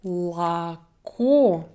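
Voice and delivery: "la ko" syllable by syllable, A then full O; O like A before stress. Shown as a demonstration. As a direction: sound out "la ko", stressing "ko". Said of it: "the sound is like a".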